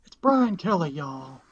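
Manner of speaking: hammed-up Southern drawl, high on Brian; mock Southern accent. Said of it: "Southern accent".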